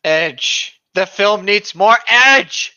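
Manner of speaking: slow, loud, drawn-out demand; booming Disney demand. Retold or imitated. Retelling Disney's notes, booming slow demand. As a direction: hammer home Disney's demand like a decree. Disney's repeated response acted.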